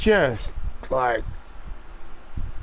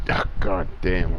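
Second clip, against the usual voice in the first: normal talking voice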